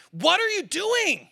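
retold dad losing his patience; frustrated outburst. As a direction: let the frustration burst out. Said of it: "I came a little too strong".

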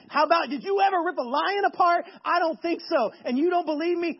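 boastful challenge. Acted. Acting out boasting to friends.

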